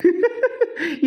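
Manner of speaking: light giggle; short tittering laughter; amused laugh. Acted. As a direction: giggle before explaining. Laughing at being thought mad.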